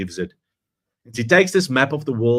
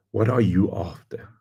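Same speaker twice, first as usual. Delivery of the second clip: whispered question to the audience. Acted. Speaker whispers his challenge to the audience. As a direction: drop to a hushed whisper.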